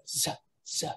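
"z" said as an isolated TZ sound; "z" as a short buzzed consonant, repeated; TZ sound demo. Shown as a demonstration. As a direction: demonstrate "z" as the letter sound TZ, not its name. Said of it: "or a T Z sound".

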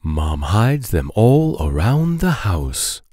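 ordinary delivery

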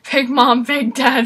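ordinary talk, nothing marked

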